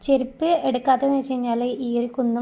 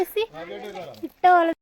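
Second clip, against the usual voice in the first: excited exclaim; 7 semitones higher than usual. Real, not acted. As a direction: exclaim it with delight. Exclaiming about cool air.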